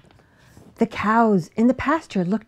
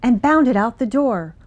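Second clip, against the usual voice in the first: plain reading voice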